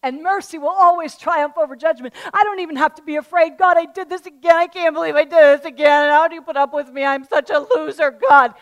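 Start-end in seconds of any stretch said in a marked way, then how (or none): 3.6-8.5 loud, high mock self-flagellation; self-pitying believer. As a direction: play the despairing sinner wailing to God. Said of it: "That is not what I'm talking about".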